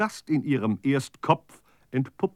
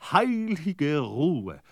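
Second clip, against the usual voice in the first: blissful calm; 2 semitones higher, much slower than usual. Acted. Wishes the voice would stop.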